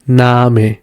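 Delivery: "Name" with a drawn-out ah; long A. Shown as a demonstration. Teacher repeating for learners, held A in "Name". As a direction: stretch the A in "Name": naah-meh. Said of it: "as a long A".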